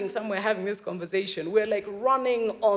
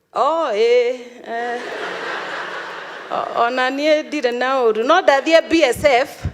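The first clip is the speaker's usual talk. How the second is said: lightly voiced old father, louder, laughing; dad on the phone; 4 semitones higher, 6 dB louder than usual. Dad on the phone, a hint of a cheerful father. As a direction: play the cheerful unaware dad.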